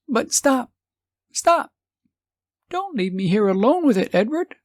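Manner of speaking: high, urgent calling out; a wife calling her departing husband back, panicked; frightened plea. Acted. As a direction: beg him not to leave. Wife calls after husband.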